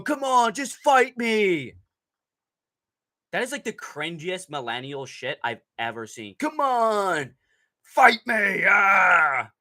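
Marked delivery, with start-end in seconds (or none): 0.0-1.7 mocking a fighter's taunt. Streamer mocks a fighter's late taunt, high hammed-up bravado. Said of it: "throwing fucking kicks or going". 6.4-9.5 high, loud mock tough-guy taunt, slow on fight me; mocking a fighter's taunt. Streamer mocks a fighter's late taunt, high hammed-up bravado. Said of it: "throwing fucking kicks or going".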